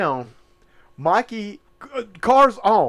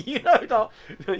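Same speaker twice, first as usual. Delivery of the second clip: ordinary talk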